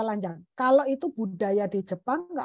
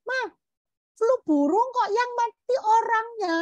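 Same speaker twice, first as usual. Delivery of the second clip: high excited call to mom; child calling out; 9 semitones higher, 3 dB louder than usual. Retold or imitated. Young son shouting to mom about the news, excited. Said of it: "Terus dia teriak".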